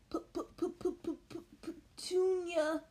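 high, slow shocked stammer; gasping stammer. A narrator's reading. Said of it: "he gasped".

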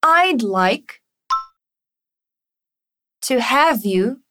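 slow teacher modelling; slow chunked drill. Shown as a demonstration. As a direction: piece by piece, slow and clear. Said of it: "Vamos praticar a pronúncia dessa frase?".

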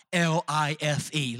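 letter by letter, slow and loud; spelled out. Acted. Spelling the word out.

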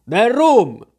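loud, high repeated demand; insistent cry. A narrator's reading. Wounded captain demands rum from the boy, insistent shout. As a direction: shout the demand, pitch leaping up. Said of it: "ripeté".

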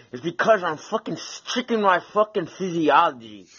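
loud, high, intense declaration; fired-up shout. Real, not acted. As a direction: hammer it home, all fired up. Fired-up self-help rant.

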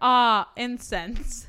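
slow, drawn-out 'Ah, incense'; slow savoring sigh. Pretend. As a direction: savor every syllable slowly. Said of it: "So my shit should be screaming".

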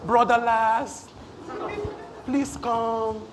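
slow, mock-gentle coaxing call; softly sung-out pleading, making fun of timidity. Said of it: "It was not".

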